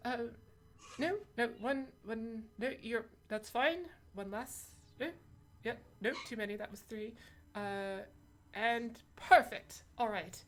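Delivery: brisk, lightly voiced shopkeeper counting coins; a hint of a fussy shop owner. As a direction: count the coins back, busy merchant.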